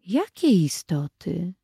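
lightly voiced small girl, slow; little girl asking. Little sister asking about the creatures, slow and lightly voiced. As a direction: ask slowly as a curious little girl. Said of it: "zapytała Irenka".

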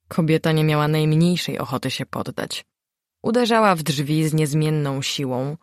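plain reading voice

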